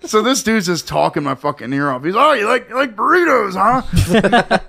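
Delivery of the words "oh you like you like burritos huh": chummy stranger. Retold or imitated. Mimics chatty stranger.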